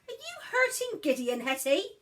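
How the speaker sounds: put-on a mother checking on her children. Mother bursting in on her daughter, suspicious. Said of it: "said Mother, bustling into the room".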